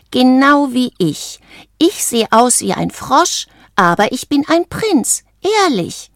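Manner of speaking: high-pitched character voice; insistent frog friend. A narrator's reading. Valentino telling Isabella who she is, high and insistent.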